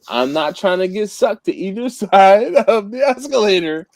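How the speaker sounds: amused protest through a laugh; laughing through words. Real, not acted. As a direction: still cracking up while explaining. Still laughing after own joke.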